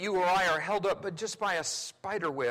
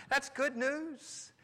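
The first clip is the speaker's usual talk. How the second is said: slow and beaming, drawn out; smiling good news; 7 semitones higher, 3 dB quieter, much slower than usual. Shown as a demonstration. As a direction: let the smile show on "That's good news". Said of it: "if your heart is happy, tell your face".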